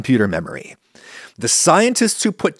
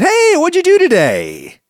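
high, bright casual question; cheerful friend asking; 5 dB louder than usual. Retold or imitated. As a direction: ask it like an upbeat friend. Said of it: "and someone said".

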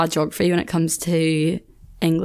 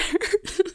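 a short, much higher squealing laugh; high squeal of laughter; 12 semitones higher, 5 dB quieter than usual. Real, not acted. Laughing at her friend's war zone question.

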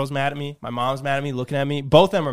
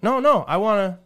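higher-pitched retold insistence; insistent mom; 6 semitones higher than usual. Mom insists on fetching son's laundry, insistent. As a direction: lightly act out a mom insisting.

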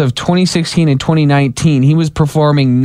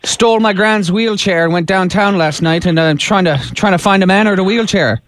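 put-on a light Irish accent on a prank call; 2 semitones higher than usual. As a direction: play an Irish caller hunting for his brother-in-law. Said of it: "Jim is gonna do his best Irish accent".

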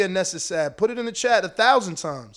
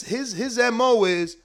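ordinary talk

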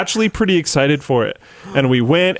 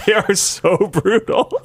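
laughing; 8 semitones higher, slower than usual. Real, not acted. Laughing at harsh ratings.

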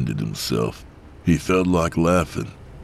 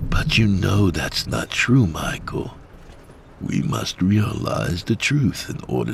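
sinister coaxing voice; 4 semitones higher than usual. Acted. Quaker persuading.

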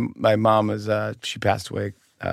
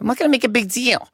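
high, fast mom voice; mock mom insisting; imitating mom; 12 semitones higher, faster than usual. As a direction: protest too fast, pitched high. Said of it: "She's like".

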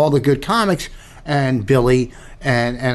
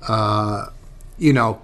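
normal talking voice

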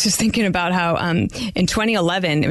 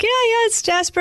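much higher, bright retold yes to the jogger; eager reply. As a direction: pop up high, delighted to be asked. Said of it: "We're like".

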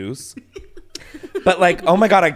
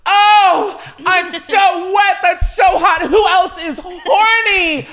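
high, loud, breathless mock moaning; mock sexy screaming; 12 semitones higher, 4 dB louder, much slower than usual. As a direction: scream it like a hammy porn star. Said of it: "I literally am screaming".

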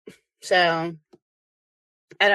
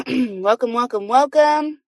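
rising, louder repeated welcome; enthusiastic welcome; 5 semitones higher, 3 dB louder than usual. Real, not acted. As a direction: sing out the welcome to the room. Host welcoming live audience.

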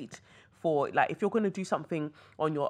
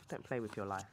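softer, lower repeat of "Don't play with your life."; low quiet echo of "Don't play with your life."; 5 semitones lower, 8 dB quieter than usual. Shown as a demonstration. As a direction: drop it to a quiet warning.

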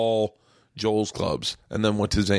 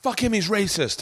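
high mock outrage; 11 semitones higher than usual. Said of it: "they were like".

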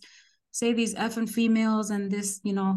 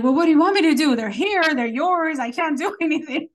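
higher, louder exasperated comeback; exasperated retort; 6 semitones higher, 6 dB louder, faster than usual. Retold or imitated. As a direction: throw it back at him, fed up. Heated exchange with the angry sergeant.

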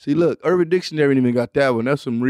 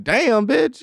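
impressed exclamation; 9 semitones higher than usual. Retold or imitated. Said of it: "You like".

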